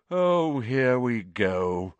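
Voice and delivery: slow, sour resignation; long-drawn weary grumble; bitter. Acted. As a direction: draw it out bitterly, falling in pitch. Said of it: "said nearly headless Nick bitterly".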